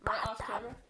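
hushed whisper. Real, not acted. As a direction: whisper it aside off camera. Whispered aside off camera.

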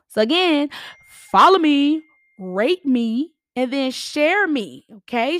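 rally the listeners loudly. Real, not acted. Loud closing call to action.

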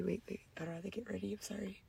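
whisper. Real, not acted. Nervous before a confession.

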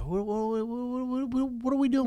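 high, stammering repeated what. Retold or imitated. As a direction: stutter the whats, climbing high. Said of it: "Same thing I said on the show".

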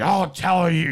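hammed-up throaty old action star; Harrison Ford. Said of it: "when he gets that rumble in his throat".

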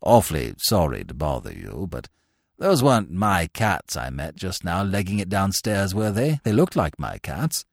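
low, mild apologetic chap; polite, diffident young gent. A narrator's reading. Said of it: "he said apologetically".

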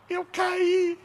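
put-on high, small child's answer; lightly voiced small boy; little boy voice. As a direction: answer like a timid kid.

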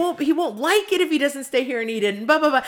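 hammed-up worried cook protesting; imitating Mark. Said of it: "and Mark's like".

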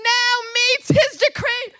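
high, loud preaching at full pitch; full-force preaching. Real, not acted. Preacher declares a cry nothing could silence, full-force shout. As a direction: thunder it out without letting up.

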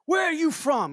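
high, loud barked question; retold yell. An older man yelling at a stranger in a shop, loud and high. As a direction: boom it out like a gruff stranger startling you. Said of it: "and he yells at me".

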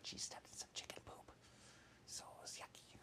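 hushed whisper, grossed out; whispered aside. A narrator's reading. Narrator whispering to the children about the poop. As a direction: whisper it like a secret.

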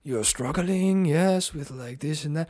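high, very slow mock English accent; hammed-up channeled Englishman, words stretched out; Doctor James's English accent. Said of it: "can you even do the accent that I showed you?".

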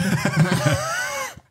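a sudden high burst of laughter. Real, not acted. Laughing at the woman who asked his question first.

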